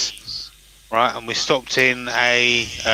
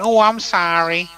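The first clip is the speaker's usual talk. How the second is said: high, slow, mock American lady's apology; American tourist impression; imitated American accent; 7 semitones higher, slower than usual. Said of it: "That's not a bad accent".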